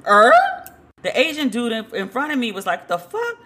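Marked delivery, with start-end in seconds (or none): 2.9-3.4 high, rising mock-stunned question; shocked disbelief. Bystander in line reacting to what he overheard, mock shock. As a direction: squeak it upward in imitated disbelief.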